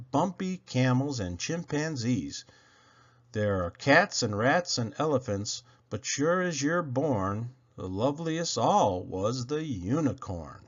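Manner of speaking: ordinary delivery, nothing marked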